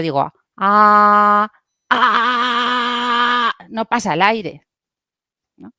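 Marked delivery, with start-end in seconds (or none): 0.6-3.5 loud, squeezed ah with throat clamped shut; pressed strained ah. Shown as a demonstration. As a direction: push the sound through tight vocal cords. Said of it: "si yo aprieto demasiado".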